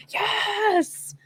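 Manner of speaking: high, joyful cheer; mock fan cheering at the radio on the freeway; excited yes. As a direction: celebrate a big hit alone in the car. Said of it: "I'm the one in the car on the freeway".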